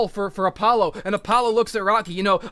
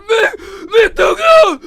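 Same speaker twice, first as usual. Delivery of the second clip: imitating a sobbing wail, higher and louder; crying plea; 12 semitones higher, 10 dB louder, slower than usual. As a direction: cry out desperately to the dying man. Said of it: "and he's crying".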